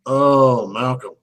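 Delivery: moaning about the actor mid-movie, understated; mock lament. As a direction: sigh the name in mock despair. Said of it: "I'm like".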